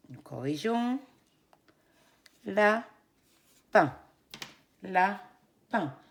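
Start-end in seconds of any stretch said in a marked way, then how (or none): 2.5-4.0 loud, drawn-out la-pin; syllable split. Shown as a demonstration. Teacher to kids, la-pin in two beats. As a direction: beat out both syllables. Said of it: "Deux syllabes".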